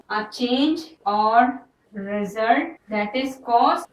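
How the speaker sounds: very slow, word by word; slow dictation. Shown as a demonstration. Teacher dictating the definition to students, very slow. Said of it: "definition इसकी भी मैं लिख देती हूँ".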